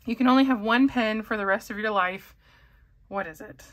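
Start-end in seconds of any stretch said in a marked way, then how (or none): 3.1-3.6 breathy whisper with a soft laugh; amused whisper. Real, not acted. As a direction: whisper it as if stumped, half laughing. Stumped by the viewer's question.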